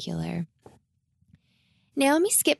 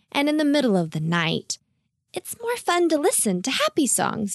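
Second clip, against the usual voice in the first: put-on high, lilting young character voice; high-pitched, kindly persuasion; encouraging Naomi; 7 semitones higher than usual. A narrator's reading. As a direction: reassure a friend brightly while gently correcting.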